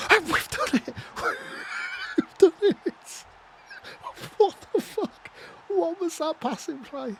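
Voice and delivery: high, loud scream of disbelief; ecstatic shouting. Real, not acted. Gamer celebrating a crazy team goal, ecstatic shouting. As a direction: shriek it out, astonished and overjoyed.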